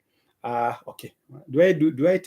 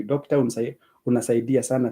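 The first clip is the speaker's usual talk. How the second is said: normal talking voice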